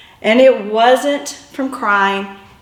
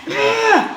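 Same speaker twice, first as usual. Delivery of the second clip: grunt; 10 semitones higher than usual. Retold or imitated. Dragged along the ground.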